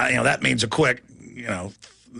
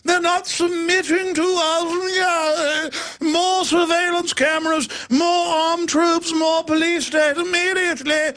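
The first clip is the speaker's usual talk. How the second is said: high, hammed-up posh aristocrat sneer; mock royal voice; 8 semitones higher than usual. Host mocking the royals' contempt, high pompous sneer. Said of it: "They're literally like".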